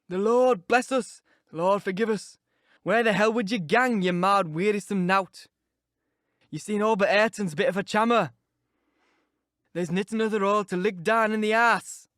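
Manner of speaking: put-on a sanctimonious old servant scandalised; pious Yorkshire servant railing. Old servant Joseph scolding the restless guest, loud Yorkshire rant.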